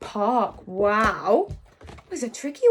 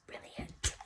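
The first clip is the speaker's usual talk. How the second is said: whisper; 17 dB quieter than usual. Shown as a demonstration. Teacher whispering praise.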